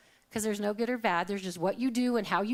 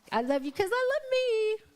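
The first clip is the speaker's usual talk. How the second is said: much higher, bright exclamation of joy; joyful burst; 11 semitones higher than usual. Real, not acted. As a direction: sing out your delight. Joyful self-love declaration.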